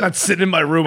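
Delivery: normal talking voice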